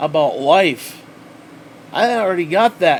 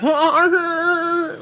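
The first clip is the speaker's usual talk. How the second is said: high, drawn-out fake-excited cry; mock excited squeal; 10 semitones higher than usual. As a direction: imitate an over-the-top thumbnail reaction. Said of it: "stupid thumbnail where it's like".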